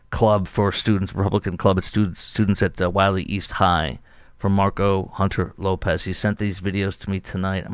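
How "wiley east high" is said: ordinary talk, nothing marked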